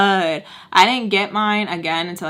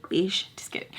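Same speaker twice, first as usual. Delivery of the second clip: soft, whispered take-back; joking whispered aside; 9 dB quieter than usual. Real, not acted. Taking back the piercing jab, whispered jokingly. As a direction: drop to a whisper to undo the jab.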